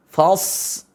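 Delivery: "Fas" with thin fa and thick long s; heavy sad. Shown as a demonstration. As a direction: hold a heavy hiss at the end of "Fas". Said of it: "Sa-nya tebal".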